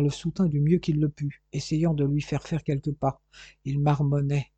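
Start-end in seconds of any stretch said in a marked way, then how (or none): none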